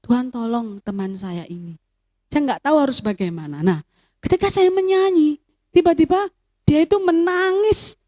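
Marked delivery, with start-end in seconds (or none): 0.0-3.6 silent prayer. Retold or imitated. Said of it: "hati saya berdoa".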